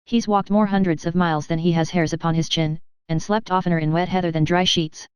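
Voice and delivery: plain reading voice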